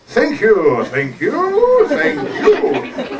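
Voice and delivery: showy, swooping thanks; mock grateful performer. Dog taking applause after playing dead, showy thanks.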